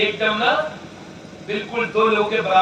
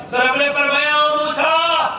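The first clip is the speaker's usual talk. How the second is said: preaching at full force; 10 semitones higher than usual. Acted. Preacher thunders the tale to the gathering, fiery. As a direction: drive the story home with fire.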